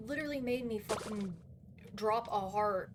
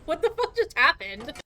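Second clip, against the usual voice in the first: ordinary delivery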